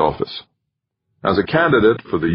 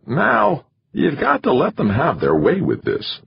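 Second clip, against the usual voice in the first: higher, pressing manager voice; insisting manager; 10 semitones higher than usual. A narrator's reading. Manager insisting the candidate give way, higher and pressing. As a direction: push him hard to give in. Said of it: "McChesney insisted".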